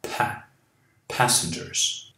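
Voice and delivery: Passengers with a strong puff of air on the P; explosive P. Shown as a demonstration. Teacher showing students the aspirated P, breathy puff. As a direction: pop the P in Passengers hard. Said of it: "o P é explosivo".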